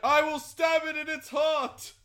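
barbarian declaration. Acted. Player as barbarian attacking the dragon, heroic declaration. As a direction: declare the strike grandly.

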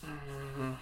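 normal talking voice

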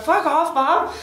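high bratty comeback; snappy teen. Retold or imitated. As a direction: fire it back like a sulky teenager. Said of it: "Margot's like".

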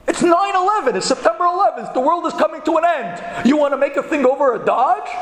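high, emphatic incredulous rebuke; appalled disbelief. Retold or imitated. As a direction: pile up the reasons at a partner who's lost his mind. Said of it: "his jaw dropped".

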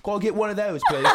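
fake London accent. American ordering in a loud bar, fake London accent. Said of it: "fake London accents".

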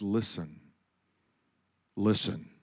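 very soft, low and hushed; God's still small voice. Acted. God speaking gently to a listening believer, still small voice. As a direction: speak it small and still, well below normal volume. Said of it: "comes with a still, small voice".

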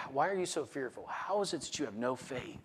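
softly recalled verse, hushed; imitated soft inner voice; still small voice. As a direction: let the questions come quietly from within. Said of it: "a still small voice".

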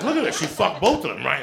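high, loud gleeful pointing-out; a giddy high exclaim at the window; excited exclaim. Retold or imitated. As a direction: point at it, thrilled and laughing. Acting out watching the cats.

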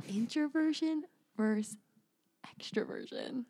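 soft announcement dropping into a whisper; ASMR voice. Shown as a demonstration. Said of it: "do an A S M R version".

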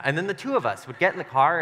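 ordinary talk

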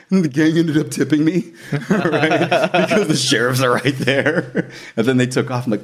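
usual speaking voice

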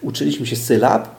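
normal talking voice

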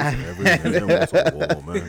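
loud, slow, through laughter; laughing. Real, not acted. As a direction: crack up while saying it. Joking amid shared laughter.

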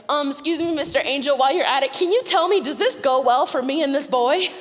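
high, loud, overly polite question; timid request. Retold or imitated. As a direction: ask the angel sweetly and nervously. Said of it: "she also didn't say".

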